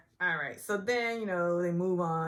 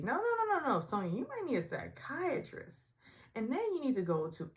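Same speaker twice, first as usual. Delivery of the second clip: mock medium advice; 9 semitones higher than usual. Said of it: "That's what she should have said".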